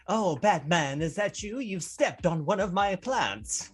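high, loud, slow hammy villainess; Poison Ivy voice. Retold or imitated. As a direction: drawl it like a camp villainess. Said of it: "and it's just like".

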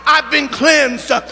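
building from chatty to loud preaching. Acted. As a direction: swell into a preacher's cry. Sermon swelling after laughter.

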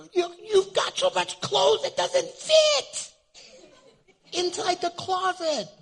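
very high, drawn-out mocking squeal; squeaky high mock voice. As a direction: pitch it way up to mock them. Mocking the spoiled person.

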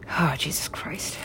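slow breathless whisper; horrified whisper. Real, not acted. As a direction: whisper it in shock.

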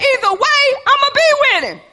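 high, loud resolve; defiant declaration. Retold or imitated. Daniel declaring he'll stay with God, high and loud. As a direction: declare it with no fear at all.

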